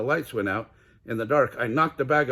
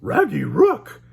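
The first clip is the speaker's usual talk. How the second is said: loud high dog-speak alarm; loud Scooby alarm; Scooby gulping; 10 semitones higher, 10 dB louder, slower than usual. Acted. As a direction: gulp out a warning. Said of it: "gulped Scooby-Doo".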